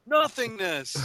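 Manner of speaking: high-pitched call without extra volume; mock cry. Playing along with the atheist joke, high mock cry. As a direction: call it out high, as a joking invocation. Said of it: "maybe you start screaming".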